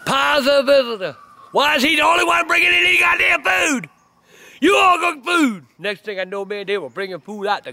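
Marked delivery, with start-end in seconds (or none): 0.0-5.6 slow, rising to a high shout; angry demand. Retold or imitated. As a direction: build from a warning to an outraged shout. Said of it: "He said".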